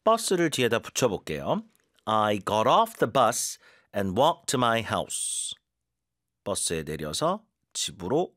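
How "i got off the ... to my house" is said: slow model sentence. Shown as a demonstration. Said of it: "뒤에 힘 주고".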